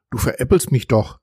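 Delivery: ordinary delivery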